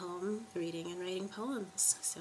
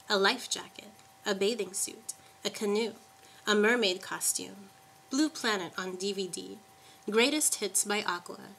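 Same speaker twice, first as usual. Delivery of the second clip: poetry reading; 2 semitones higher, 6 dB louder than usual. Acted. Poet listing gifts for a water worshipper, incantatory poetry reading. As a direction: list poem recited item by item. Reads list poem aloud.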